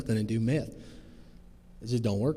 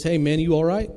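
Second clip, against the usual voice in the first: raised voice calling across; 5 semitones higher, 8 dB louder than usual. Retold or imitated. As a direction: call out to a stranger, concerned. Stranger calling out to him.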